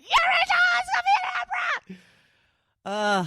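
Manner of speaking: re-enacted full-out fan shriek, extremely high; excited scream. Wife repeats news to baffled husband, screaming with excitement. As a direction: squeal it out at the top of your voice. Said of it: "I screamed".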